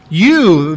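YOU stretched and leaned on; emphatic YOU. Shown as a demonstration. Preacher points out the pronoun to the congregation, emphatic YOU. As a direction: punch YOU as a word on its own. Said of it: "very emphatic".

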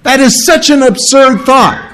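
high-pitched, emphatic dismissal; emotional disbelief. Acted. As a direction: insist with feeling that it is absurd. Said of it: "a lot of emotion put behind that".

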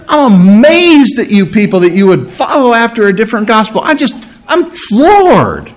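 amazed, high-pitched disbelief; floored, stretching the last word high then dropping; Paul. Acted. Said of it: "imagine the emotion that he's putting in there".

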